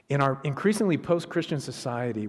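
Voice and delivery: ordinary talk, nothing marked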